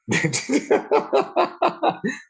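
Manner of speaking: loud, high burst of laughter; howling laugh at the band's timing; big laugh. Real, not acted. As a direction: crack up at the joke. Laughing at band cue in clip.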